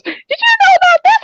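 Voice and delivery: lady confronts the deli clerk, high and suspicious; imitating the suspicious lady. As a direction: squeak it out like she suspects the clerk. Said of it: "And she goes".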